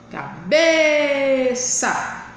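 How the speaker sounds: "cabeça" with a long, held BE; slow caBEEE-ça stress demo; stressed syllable drawn out. Shown as a demonstration. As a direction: linger on the middle syllable of "cabeça". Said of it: "demorar mais com ela na boca".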